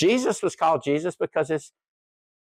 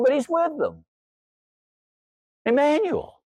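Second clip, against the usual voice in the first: higher, slower preaching build; 8 semitones higher than usual. Acted. Pastor preaching the meaning of Jesus' name, rising fervour. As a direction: lift into the sermon's climax, slowly.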